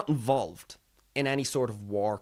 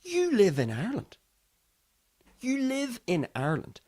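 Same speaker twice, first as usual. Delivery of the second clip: "You live in Ireland" pitched high, each time set apart; pointed thought; 8 semitones higher than usual. Acted. Man to headline reactors, pointed "You live in Ireland". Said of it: "and thinking".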